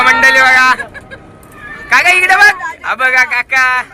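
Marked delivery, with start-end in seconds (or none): 1.9-2.5 calling out. Real, not acted. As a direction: call loudly and higher to get his attention. Calling uncle on the bus.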